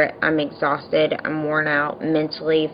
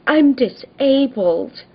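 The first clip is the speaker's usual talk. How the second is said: high, stretched mock whine; mock victim wail; 8 semitones higher, 4 dB louder, slower than usual. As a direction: mimic a neighbour playing the victim. Imitating the neighbour's complaint.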